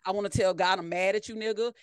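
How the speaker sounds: usual speaking voice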